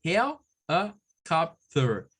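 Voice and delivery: Helicopter slowly, HE-li-cop-ter, syllable by syllable. Shown as a demonstration. Teacher showing the syllable split. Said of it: "Fíjense cómo es la separación silábica".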